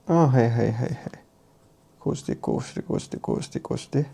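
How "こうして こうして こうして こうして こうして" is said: unvoiced mutter while reading notes; whispering to herself. Real, not acted. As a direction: whisper under breath while checking.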